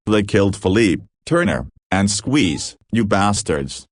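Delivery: plain reading voice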